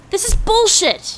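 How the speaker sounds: angry protest. Acted. Being arrested.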